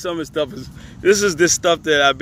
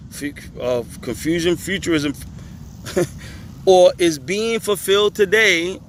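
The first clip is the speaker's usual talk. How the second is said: ordinary talk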